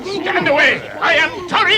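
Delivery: loud, high battle cry; defiant shout. Acted. Dwarf shouting defiance at the trolls, loud and high. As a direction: bellow your name at the trolls.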